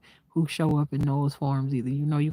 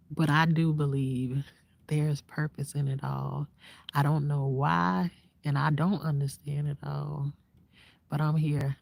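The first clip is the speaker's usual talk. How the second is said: usual speaking voice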